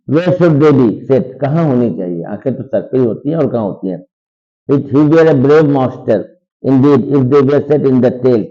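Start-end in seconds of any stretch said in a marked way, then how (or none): 0.1-1.2 slow, louder recital of the line; slow dramatic reading. Shown as a demonstration. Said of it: "teacher reads text slowly". 4.7-8.4 slow, louder recital of the line; slow dramatic reading. Shown as a demonstration. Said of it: "teacher reads text slowly".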